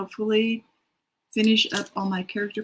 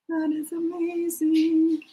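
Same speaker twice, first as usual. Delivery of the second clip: drawn-out amazement; 8 semitones higher, slower than usual. Real, not acted. Reacting to a writer's progress.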